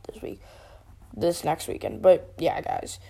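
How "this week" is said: hushed whisper. Real, not acted. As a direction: drop into a whisper. Sudden whisper mid rant.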